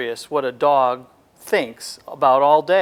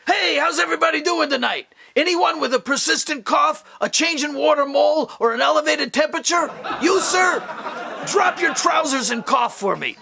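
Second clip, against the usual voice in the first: hammed-up stand-up act, pitched way up; Fauci as stand-up comic; 9 semitones higher than usual. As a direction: work the room like a nightclub comic. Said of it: "Doctor Fauci would have a great career as a stand-up comedian".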